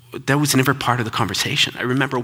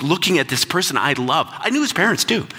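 ordinary talk, nothing marked

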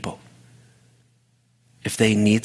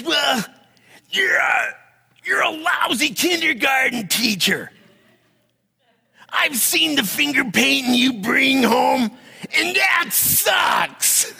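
very high-pitched yelled insults, hammed up; triggered mock tantrum; slower than usual. Triggered boyfriend insulting the teacher, mock tantrum. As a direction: drag out the insults in a comic outburst. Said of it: "he's triggered".

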